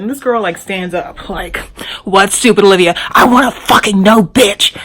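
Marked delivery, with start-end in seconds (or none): 2.0-4.8 angry shout. Retold or imitated. Friend stands up and yells at Olivia, shouting.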